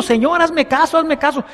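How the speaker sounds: high-pitched mock nagging plea; acted insistent begging; nagging insistence. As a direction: keep pestering him, over and over. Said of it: "duro y duro y duro y duro".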